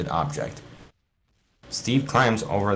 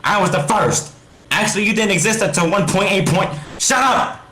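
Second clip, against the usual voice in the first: very high, loud screaming rant; 11 semitones higher, 5 dB louder than usual. Acted. Alex screaming at Steve, very high and loud. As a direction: scream her down. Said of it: "she screams".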